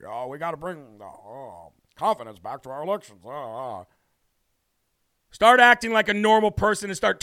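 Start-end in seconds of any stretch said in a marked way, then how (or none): 0.0-3.8 much lower, much softer mumbling; a mumbling old senator; mocking Mitch McConnell, low, soft and mumbled; Mitch McConnell impression. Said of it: "Sounds like Mitch McChina".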